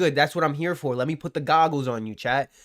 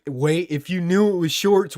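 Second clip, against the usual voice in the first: ordinary talk, nothing marked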